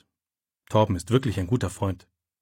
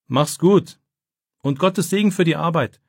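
calling after; 6 semitones higher than usual. A narrator's reading. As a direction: call it out as he runs off. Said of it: "ruft Torben ihm nach".

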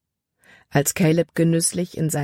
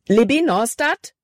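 high, urgent call of her name; panicked call; 7 semitones higher, 4 dB louder than usual. A narrator's reading. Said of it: "Calebs Stimme war panikerfüllt".

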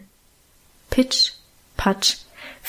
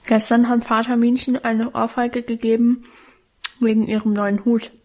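undeutlich kauend. Acted. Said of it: "berichtete ich undeutlich und stockend während des Kauens".

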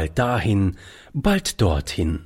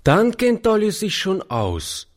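put-on high, confident child voice; little girl; 10 semitones higher than usual. A narrator's reading. Little girl reassures her grandfather, in a high child voice. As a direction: say it as a high-pitched small child. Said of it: "sagte das Kind".

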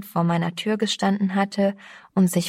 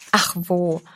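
sobbing. A narrator's reading. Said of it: "schluchzte sie".

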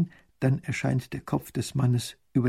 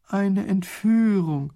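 a slow, higher dismayed groan; despairing moan at the news; 7 semitones higher, much slower than usual. Acted. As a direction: baron groaning over a kidnapping, slow and dismayed. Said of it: "stöhnt Raffigny".

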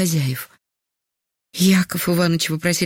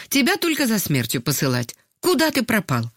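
higher, nervy scolding at usual volume; nervous call; 7 semitones higher than usual. A narrator's reading. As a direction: call out a nervous reproach, pitched up. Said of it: "нервно крикнула". (нервный окрик, повыше)

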